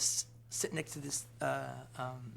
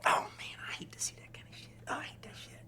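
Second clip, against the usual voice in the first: disgusted whisper; 10 semitones higher, 5 dB quieter than usual. Retold or imitated. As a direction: whisper it, turning away from the kiss. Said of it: "he's like".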